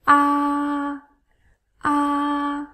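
"A" held long, high and level; high first tone. Shown as a demonstration. Teacher demonstrates the first tone, high level "a". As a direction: sustain "a" on one steady high pitch. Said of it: "is a high tone".

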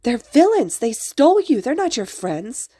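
high, loud urgent protest; yelled warning. A narrator's reading. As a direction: shout to convince a friend. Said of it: "the brown-haired one had yelled".

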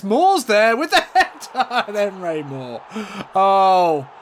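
delighted commentator laughing at the header; excited goal call. Real, not acted. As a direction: celebrate the header.

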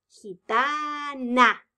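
slow, stretched gitana; syllable by syllable. Shown as a demonstration. Teacher confirming the answer, sounding it out slowly. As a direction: draw out each syllable. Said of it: "teacher sounds out answer".

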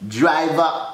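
ordinary talk, nothing marked

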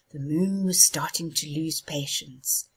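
ordinary delivery

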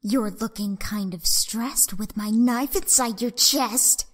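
low, soft, sinister threat; menacing villain. Acted. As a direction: lean in, quiet and deadly. Killer taunts Sans.

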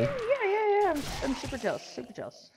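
high squeaky start tumbling down to a quick mutter; mock concession. Admitting jealousy to a bragging friend, mock-placating. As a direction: humour the boaster, fast and dismissive, trailing off.

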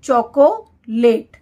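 Chocolate stretched out very slowly, syllable by syllable; slow teaching read. Shown as a demonstration. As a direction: spell out chocolate slowly for the class. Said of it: "क्या है हमारा?".

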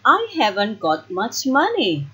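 plain reading voice

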